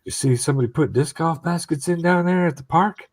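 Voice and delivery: lightly voiced highway guy; highway worker. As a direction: ask Dave like a nosy coworker. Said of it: "one guy's gonna be like".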